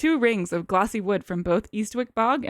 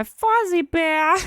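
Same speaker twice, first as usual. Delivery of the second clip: high, loud, drawn-out muppet bear voice; Fozzie Bear; 7 semitones higher, 6 dB louder, much slower than usual. Acted. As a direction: stretch it out like a comic puppet bear. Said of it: "Is that what Fozzie Bear is?".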